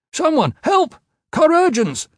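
high, loud alarmed shout; shouted cry for help. A narrator's reading. Bystander calling for help as woman has seizure, urgent shout. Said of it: "a bystander shouted".

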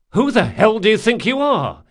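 loud, high furious demand; angry outburst. Acted. Jean furious at Locke over the antidote, loud angry shout. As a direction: lash out at your friend.

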